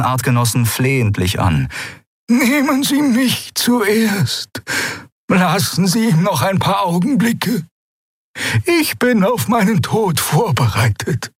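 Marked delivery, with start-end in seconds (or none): 2.3-11.3 high, slow, raised plea; pleading old Xea. A narrator's reading. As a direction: beg them to take her first. Xea pleads to die first.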